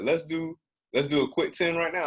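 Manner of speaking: high retold proposal; imitated coworker's suggestion. Voices a dude's placating suggestion.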